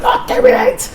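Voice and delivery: high, laughing give-up; laughing refusal. Retold or imitated. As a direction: laugh it off, done with it.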